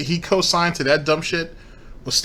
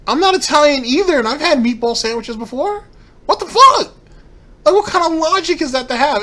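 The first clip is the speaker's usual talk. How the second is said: much higher and louder, outraged disbelief; incredulous yelling; exasperated inner rant; 13 semitones higher, 7 dB louder than usual. Retold or imitated. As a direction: shout your own thoughts back at the screen. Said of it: "sticking out my head being like".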